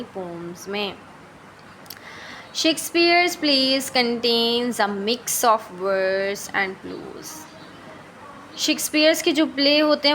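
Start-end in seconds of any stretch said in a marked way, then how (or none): 2.5-7.3 slow, deliberate dictation of English notes; reading notes aloud. Shown as a demonstration. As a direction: read each word out slowly for the class. Reads English notes slowly.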